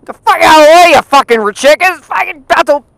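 high, loud ranting shout; exasperated high-pitched tirade; angry yelling. Real, not acted. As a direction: holler at animals blocking the road. Yells at chickens on the road.

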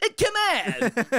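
high, slow hammed-up Italian; Italian accent. Gamer doing Mario's Italian "come on", slow and high. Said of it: "How Italian".

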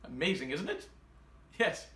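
normal talking voice

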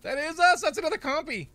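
high and loud; excited. Real, not acted. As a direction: blurt it out thrilled. Excited spotting in game.

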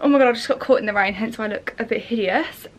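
normal talking voice

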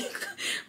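breathy little chuckle; a quiet amused huff; soft laugh. Real, not acted. After calling it chaotic.

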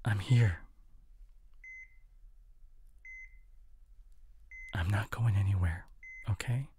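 hushed bedside whisper. Acted. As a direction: soothe them to sleep softly. Bedside, partner resting.

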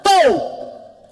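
loud, high shouted bang; shouted gunshot. Retold or imitated. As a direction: yell the gunshot noise. Imita um tiro gritado. (grito imitando um tiro)

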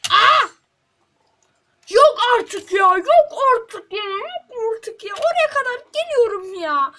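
loud, high, slow anguished yelling; raging gamer shout; furious yell. Real, not acted. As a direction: scream in disbelief at losing. Dies after long effort.

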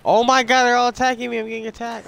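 high, loud panicked cry; real alarm. Real, not acted. Player calling out as enemies all attack, alarmed shout.

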